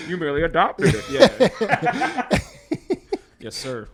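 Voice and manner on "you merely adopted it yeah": high, louder, laughing through the line; laughing mock villain quote; laughing Bane quote. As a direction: giggle out the movie line.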